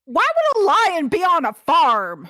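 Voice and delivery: high, loud and indignant; incredulous shout. Pretend. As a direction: throw the absurd question back loudly. Player indignantly answers game master.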